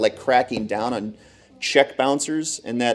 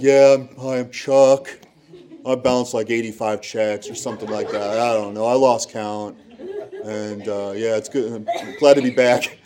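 a lightly voiced laid-back guy, chuckling through it; carefree repeat offender. Chuck introducing himself at the seminar, easygoing. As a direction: shrug it off like a regular.

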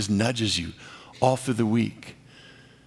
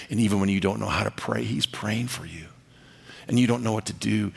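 normal talking voice

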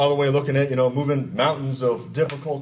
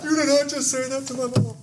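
much higher mock-outraged voice; laughing son-in-law; 10 semitones higher than usual. Son-in-law defending his mother, high mock outrage. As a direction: play the classmate, shocked and laughing. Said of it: "he starts laughing like".

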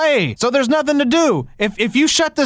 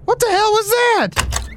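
shocked outburst; 11 semitones higher, much slower than usual. Acted. Host reacts to the exploding teletype, high and stunned. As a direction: react to a sudden blast.

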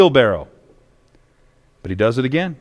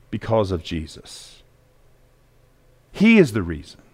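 hushed; 8 semitones lower, 5 dB quieter than usual. Real, not acted. Preacher hushing the congregation on Jesus. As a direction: drop to a near hush, much lower.

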